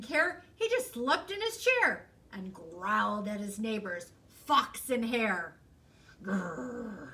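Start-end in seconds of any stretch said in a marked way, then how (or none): 6.2-7.1 bear growl. Acted.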